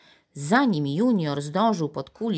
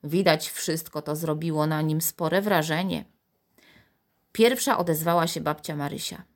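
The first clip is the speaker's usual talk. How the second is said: ordinary delivery, nothing marked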